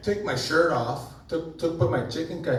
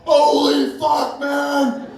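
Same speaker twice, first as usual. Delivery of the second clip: much higher, louder slow shout; panicked shout; 10 semitones higher, 6 dB louder, much slower than usual. Retold or imitated. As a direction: yell in mock panic at the fire. Room on fire in story.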